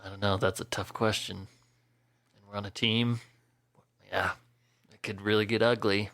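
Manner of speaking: low, soft, halting answer; a hint of awkward tenderness, with long pauses; lightly voiced reticent Thane. Thane answers Karen about romance on the team, softly hesitant.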